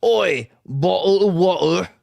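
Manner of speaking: slow mock English accent. Shown as a demonstration. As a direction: drop the t's in a broad English accent. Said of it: "my, uh, English or my".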